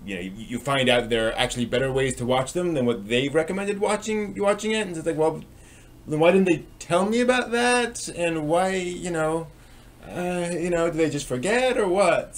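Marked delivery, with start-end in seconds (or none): 3.8-12.4 pitched up high, exasperated and incredulous; why didn't anyone tell me, in disbelief; exasperated rant. Real, not acted. Venting about bad recommendations.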